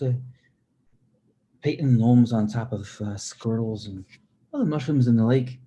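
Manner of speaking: lively put-on Irish brogue with high lilts; Irish accent. Shown as a demonstration. Showing viewers an Irish accent with talk of family and tattoos, playful brogue. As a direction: ramble on in an amateur Irish accent. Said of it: "let me know how good my Irish accent is".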